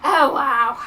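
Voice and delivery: loud mock-British "wow"; big, loud British-accented exclamation; British cousin. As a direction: imitate a British cousin, loudly. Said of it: "the one who Kathy knows as".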